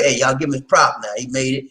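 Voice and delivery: usual speaking voice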